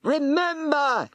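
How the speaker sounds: high, slow roar. Acted. Warlock roaring at the serpent. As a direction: command the beast. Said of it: "he roared".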